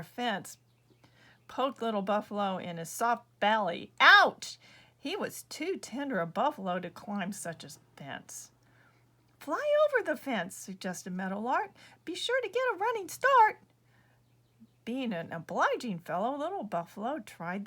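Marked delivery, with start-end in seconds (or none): 4.0-4.6 sharp high loud yelp of pain; pained ouch. Acted. Buffalo poked by barbed wire, loud high yelp. 9.4-10.5 high, louder bird voice; meadowlark advice. Acted. Meadowlark urging buffalo to fly, high chirpy voice. 12.0-13.6 high, louder bird voice; meadowlark advice. Acted. Meadowlark urging buffalo to fly, high chirpy voice.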